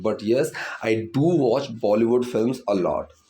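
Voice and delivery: very slow, do pressed firmly; stress on do. Shown as a demonstration. Teacher repeating the full example for students, emphatic do. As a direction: affirm it by leaning on do. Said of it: "उस चीज़ पर थोड़ा सा दबाव डालते हैं".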